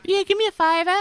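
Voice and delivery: very high squeaky joke voice; silly put-on voice. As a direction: disguise your voice, squeaky high. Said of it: "Put a voice on".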